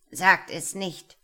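slow, loud, menacing command; grim, forceful warning; grim shout. A narrator's reading. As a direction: thunder it like an evil storm cloud. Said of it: "rief grimmig eine böse schwarze Wolke".

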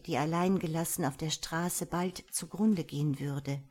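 usual speaking voice

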